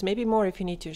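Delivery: ordinary talk, nothing marked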